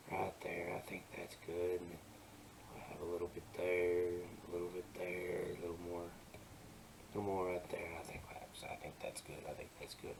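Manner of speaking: very soft, half-whispered self-talk; muttering to himself. Real, not acted. Artist talking to himself while touching up shadows, quiet muttering. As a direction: talk under your breath as you check your work.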